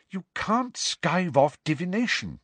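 severe. A narrator's reading. Hermione tells off a friend for skiving, severe. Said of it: "said Hermione severely".

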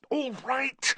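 high-pitched urgent cry. A narrator's reading. Harry stopping the elf's head-banging, high urgent cry. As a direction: cry out to stop someone. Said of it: "cried Harry".

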